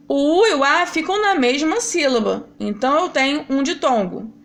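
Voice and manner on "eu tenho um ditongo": normal talking voice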